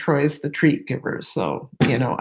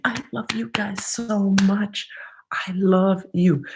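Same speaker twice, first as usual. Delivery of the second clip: whispering; 3 semitones higher than usual. Real, not acted. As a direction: whisper it warmly. Whispered affection.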